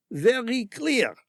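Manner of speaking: put-on high, slow French-accented submission. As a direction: agree fast in French accent.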